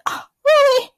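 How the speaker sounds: high, loud mock surprise; a squeaked "oh really?". Sam hearing the threat, mock surprised. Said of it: "And Sam's like".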